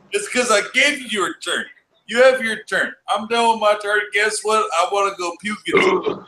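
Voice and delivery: high, exasperated bickering; loving exasperation. Real, not acted. Exasperated reply to friend.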